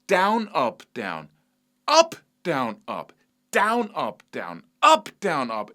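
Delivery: first word of each three punched, "down" then "up"; alternating accent demo. Shown as a demonstration. Teacher showing alternate picking, "down" and "up" accented in turn. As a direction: hit "down", then "up", as each group starts. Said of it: "this single skill of going".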